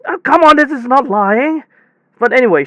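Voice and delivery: ordinary delivery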